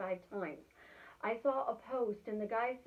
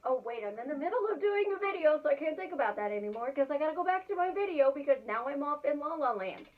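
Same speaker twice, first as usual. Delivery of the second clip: quick, louder, higher sudden realisation; flustered snap back; 4 semitones higher, 6 dB louder, faster than usual. Retold or imitated. Catching herself daydreaming mid-video, flustered and hurried. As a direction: rush it like you just caught yourself drifting.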